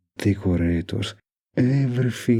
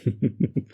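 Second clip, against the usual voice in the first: short, higher-pitched giggling chuckle; amused chuckle; 5 semitones higher than usual. Acted. Mafia boyfriend amused by partner's teasing, light chuckle. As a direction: let out a quick amused laugh.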